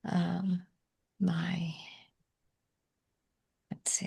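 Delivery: normal talking voice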